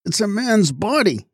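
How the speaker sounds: high, alarmed exclamation; excited cry. A narrator's reading. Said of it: "he cried excitedly".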